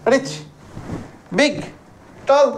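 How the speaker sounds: "রিচ", "বিগ", "টল" each as one beat; single-syllable words, separated by pauses; one breath per word. Shown as a demonstration. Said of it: "একটাই দম".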